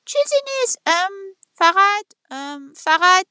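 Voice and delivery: a rooster teasing with a pause; playfully evasive rooster; hesitant rooster. A narrator's reading. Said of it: "خروس جواب داد".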